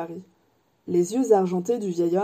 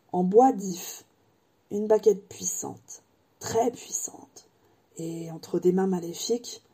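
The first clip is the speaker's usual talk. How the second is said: put-on ominous old wandmaker. Ollivander warns Harry of the yew wand, hushed and ominous. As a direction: let the danger creep into the voice.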